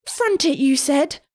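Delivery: put-on high, furious boy; raging. A narrator's reading. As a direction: rage at his friends. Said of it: "Kion raged".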